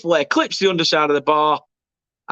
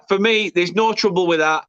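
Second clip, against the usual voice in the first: ordinary talk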